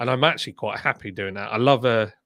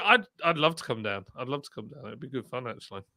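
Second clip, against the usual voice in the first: ordinary talk, nothing marked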